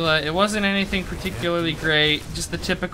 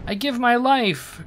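higher, slow narrated plea; crying out; 8 semitones higher, 3 dB louder than usual. A narrator's reading. Renette offering his life to the figure, crying out. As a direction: cry out desperately, in storyteller mode. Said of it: "cried Renette".

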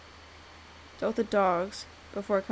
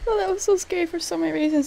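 fast and high, still rattled; excited, rattled; 9 semitones higher, 8 dB louder, faster than usual. Real, not acted. Player telling viewers about the scare, fast, high and rattled. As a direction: gush it out, heart still racing. Reacting right after the scare.

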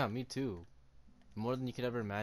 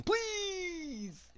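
desperate plea. Pretend. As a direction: beg for your life. Begging a skeleton not to shoot.

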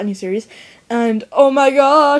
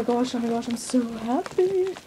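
hushed; 7 dB quieter than usual. Real, not acted. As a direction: breathe it out in awe. Hushed awe opening the package.

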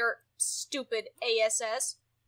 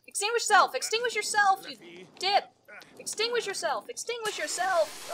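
loud, high frantic orders; frantic yelling; 6 semitones higher, 6 dB louder than usual. Real, not acted. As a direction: scream the command at him. Frantic orders to burning sim.

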